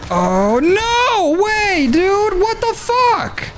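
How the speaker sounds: high, drawn-out disbelieving shout; stunned outcry. Real, not acted. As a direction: cry out in total disbelief. Enemy steals boss kill.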